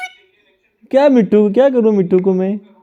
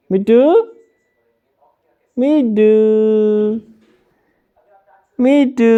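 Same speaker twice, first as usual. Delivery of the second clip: sing-song calling; 3 semitones higher than usual. Real, not acted. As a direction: call out to a pet in long notes. Calling the parrot by name. (लंबे सुर में पुकारते हुए)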